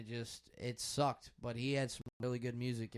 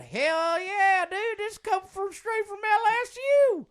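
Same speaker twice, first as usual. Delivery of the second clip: much higher and louder Louisiana twang; imitating a southern drawl; 11 dB louder than usual. Imagining the senator's reply, loud southern twang. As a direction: whoop it like a hammed-up good old boy. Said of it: "he probably said".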